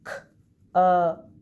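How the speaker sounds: k, up said apart, loud on up; segmented sounds. Shown as a demonstration. Teacher blending words for the class, sound by sound. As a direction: split k and up clearly. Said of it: "I'm gonna blend some words".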